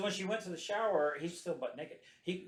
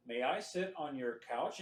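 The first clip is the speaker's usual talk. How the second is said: slow, lower monotone; robot voice; 6 semitones lower, 6 dB quieter, slower than usual. Retold or imitated. Lightly imitated robot doll asking the woman. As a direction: ask it like a machine. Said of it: "robot voice".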